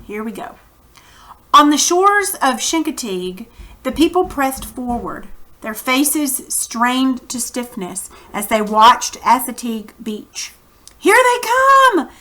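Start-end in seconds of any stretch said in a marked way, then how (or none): 1.5-10.5 reading a chapter aloud to the class; teacher narrating a children's novel; story narration. A narrator's reading. 11.0-12.1 high, loud, slow excited call; mock crowd shout on the beach; crowd cry. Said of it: "The cry broke out from every throat".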